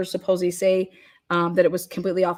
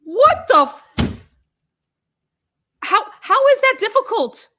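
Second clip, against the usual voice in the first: high, loud incredulous outburst; exasperated disbelief; 12 semitones higher, 6 dB louder than usual. Real, not acted. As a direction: cry out in real frustration. Exasperated at jury's supposed confusion.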